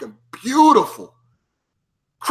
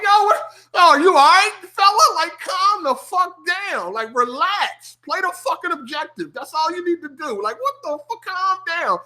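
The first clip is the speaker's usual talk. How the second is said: higher, mocking calm-down voice; mock annoyed gamer; 9 semitones higher than usual. Other players telling the noob to calm down, mocking.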